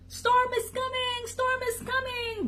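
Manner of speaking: very high duck warning; urgent, high-pitched duck cry; quacked alarm. A narrator's reading. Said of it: "ducks quacked".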